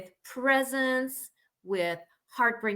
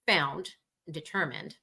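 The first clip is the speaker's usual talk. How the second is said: ordinary talk, nothing marked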